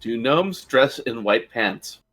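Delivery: normal talking voice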